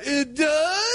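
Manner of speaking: very high squeak, hammed-up; weird voice. Son answers dad's remark on his voice, weird high squeak. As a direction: answer in a comically strangled high voice. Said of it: "Your voice sounds weird".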